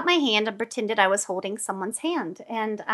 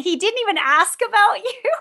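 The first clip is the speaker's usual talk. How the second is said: high, laughing retort from the friend; 10 semitones higher, 3 dB louder than usual. Retold or imitated. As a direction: laugh it out in disbelief. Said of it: "she's in hysterics saying".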